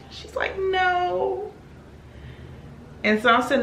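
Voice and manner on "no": high, whimpered answer; mock tearful. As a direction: imitate a crying friend, high and pitiful. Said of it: "And so she's crying".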